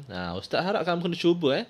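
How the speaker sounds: normal talking voice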